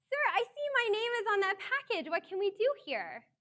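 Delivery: high and loud, chasing him down; pleading call-out. Retold or imitated. Running barefoot after the delivery man, calling out urgently.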